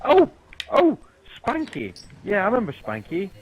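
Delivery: put-on excited "oh, that guy!"; feigned sudden recognition. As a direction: act as if the name just came back to you. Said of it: "pretended to not know who Brian Kendrick was".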